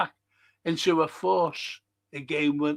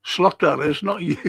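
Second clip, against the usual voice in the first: usual speaking voice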